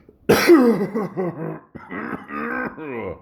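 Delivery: loud hacking cough from a sore throat; painful cough. Real, not acted. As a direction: violent throat-tearing cough. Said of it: "it really hurts to talk".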